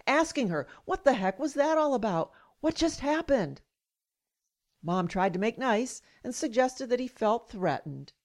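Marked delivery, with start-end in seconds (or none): 0.9-3.6 higher-pitched indignant questions; retold livid questioning. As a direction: relive the outrage, pitched up. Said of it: "I was livid".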